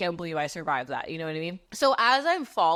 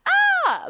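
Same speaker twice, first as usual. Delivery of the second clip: high, loud "Ah!" of fright; freaked-out scream; 8 dB louder than usual. Retold or imitated. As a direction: let out a sudden panicked shriek. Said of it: "So then I scream because I'm freaked out".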